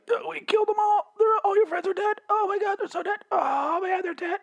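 mock the dying guy in a squeaky falsetto. Vlogger imitating a dying prisoner, squeaky falsetto whine. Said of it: "He wasn't that high pitched".

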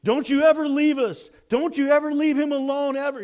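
high, loud pleading outburst; excited. Retold or imitated. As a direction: implore them loudly, all worked up. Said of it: "So he's all excited".

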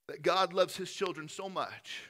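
ordinary talk, nothing marked

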